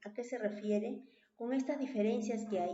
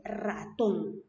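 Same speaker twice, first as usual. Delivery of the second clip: "ratón" drawn out, weight on TÓN; "ratón" as ra-TÓN, stretched for the class; stress on last syllable; 3 semitones lower than usual. Shown as a demonstration. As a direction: say "ratón" slowly, landing on tón. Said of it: "la que lleva la mayor fuerza".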